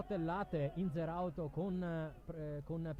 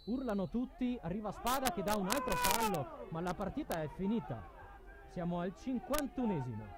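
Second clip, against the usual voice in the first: higher and louder, racing through the late drama; excited commentary; 4 semitones higher, 4 dB louder than usual. Real, not acted. Commentator to viewers as the crowd yells, excited call.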